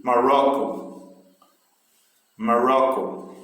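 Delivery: slow "Morocco" with a strong second syllable, ro; stress on middle syllable. Shown as a demonstration. Teacher drilling syllable stress for students, middle of Morocco stressed. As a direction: lean on the "ro" in "Morocco". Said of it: "Então, ro, né? Tem a mais".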